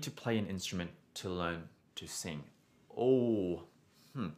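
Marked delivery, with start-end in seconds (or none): none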